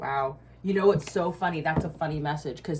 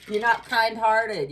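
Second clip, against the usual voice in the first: slow, loud, higher scolding; 6 semitones higher, 7 dB louder, slower than usual. Real, not acted. Scolding people who drain energy, loud and slow. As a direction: lay it on them, slow and loud.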